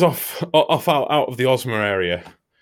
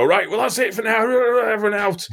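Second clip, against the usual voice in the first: boss clearing the room; 13 semitones higher, 4 dB louder than usual. Retold or imitated. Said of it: "The boss would just have to go".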